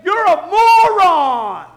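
loud, high, slow shouted insult; mock insult. Imagined outburst at a coworker, slow loud shout. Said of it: "if you would have said".

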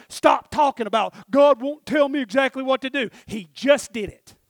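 sudden loud, punchy exhortation; forceful preaching. Real, not acted. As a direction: hammer it at the congregation. Preaching outburst.

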